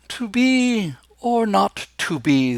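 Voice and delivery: slow, lofty received pronunciation like Olivier; posh RP imitation. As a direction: ham up a grand British RP Hamlet. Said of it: "that's called received pronunciation".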